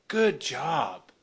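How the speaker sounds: mock the praise after naming the debt; sarcastic good job.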